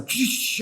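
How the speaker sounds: imitated racing line, "tch"; quick whooshing noises. Host showing an accelerated life line, quick imitated noises. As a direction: make quick hissed noises for speed.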